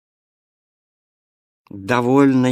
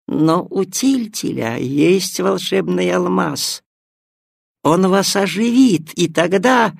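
scheming cat; 6 semitones higher, 4 dB louder than usual. Acted.

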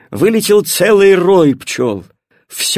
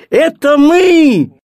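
high, loud, stretched-out cry; children shouting; 11 semitones higher, 6 dB louder, much slower than usual. A narrator's reading. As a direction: announce yourselves with a joyful shout. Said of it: "закричали дети". (звонкий детский крик)

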